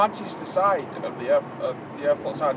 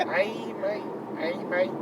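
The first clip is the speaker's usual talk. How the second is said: higher, trying out a Manc "Hey, mate"; Manc accent practice; 5 semitones higher, 4 dB quieter, slower than usual. Shown as a demonstration. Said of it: "There we go".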